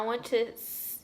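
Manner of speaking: ordinary delivery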